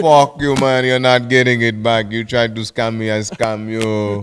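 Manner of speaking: put-on loud Indian-accented retort, easing off; mock Indian accent. Scam-baiter refusing a refund, mock Indian accent. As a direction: gloat back at the scammer in Indian accent. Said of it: "they get so angry".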